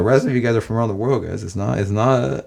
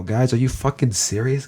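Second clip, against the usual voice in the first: ordinary delivery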